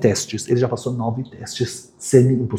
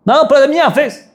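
put-on high whiny kid's protest; boy insisting it's his turn; 4 dB louder than usual. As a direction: squeal it like a kid who won't share. Kid refusing to give bat.

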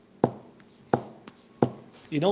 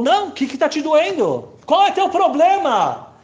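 high, loud and slow pushing; a loud, slow insistent old man; 10 semitones higher, 7 dB louder, slower than usual. Retold or imitated. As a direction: press him to open up, loudly. Old man pressing the crying narrator.

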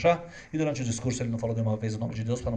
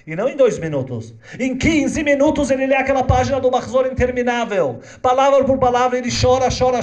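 higher, louder impassioned narration; preaching at full force; 10 semitones higher, 8 dB louder than usual. Acted. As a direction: preach the scene with rising emotion. Narração em pregação intensa. (pregação intensa, aguda e alta)

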